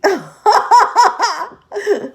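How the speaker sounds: loud squealing laugh at herself; real embarrassed cackle; loud high laugh. Real, not acted. Laugh at own confession.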